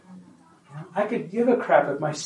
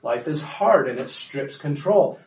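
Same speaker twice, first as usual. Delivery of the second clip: ordinary talk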